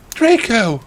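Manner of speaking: high, hammed-up cry of a name; calling out. Heroine spotting Draco in the woods, high call. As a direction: melodramatic mock call. Heroine calls out to her boyfriend.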